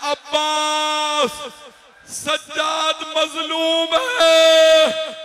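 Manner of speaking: very slow, high wailing shout; loud call. Acted. Zainab calls to Abbas from the river in a drawn-out wailing shout. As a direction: cry out across the river in anguish. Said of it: "बुलंद आवाज से आवाज दी".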